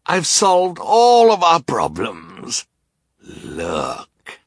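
slow, high sneering villain. Acted. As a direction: drawl it like a gloating rogue. Narrator voices villain Count Olaf.

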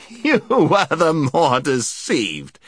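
slow words through laughter; laughing. A narrator's reading. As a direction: chuckle out the reply. Knight laughing while speaking.

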